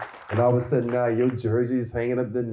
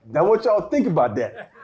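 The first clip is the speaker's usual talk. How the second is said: ordinary talk, nothing marked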